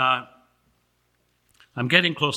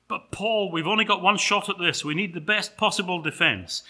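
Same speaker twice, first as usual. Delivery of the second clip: higher, urgent appeal; pleading angel; 6 semitones higher than usual. Acted. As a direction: plead with a stubborn client.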